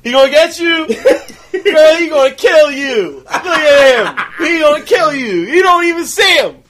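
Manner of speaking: high, loud heckling at the screen; hammed-up cinema loudmouth; rowdy movie heckler. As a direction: imitate a man hollering at a film.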